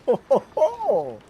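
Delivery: put-on slow, loud old-man chuckle. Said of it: "a very old man hyper voice".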